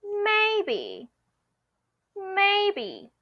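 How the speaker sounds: drawn-out, high level 'maybe' with a sly smile; tricky, noncommittal. Shown as a demonstration. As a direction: sing 'may' high and long, then drop on 'be'. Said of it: "give them a tricky smile and then say".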